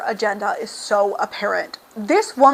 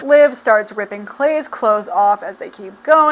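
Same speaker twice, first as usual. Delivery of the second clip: ordinary delivery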